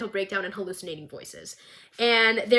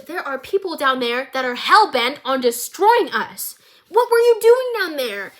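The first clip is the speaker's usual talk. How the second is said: rising pitched, louder accusation; heated demand; 4 semitones higher, 6 dB louder than usual. Retold or imitated. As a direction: Jace snaps at his partner, voice climbing. Jace arguing with Victor.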